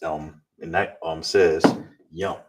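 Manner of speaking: usual speaking voice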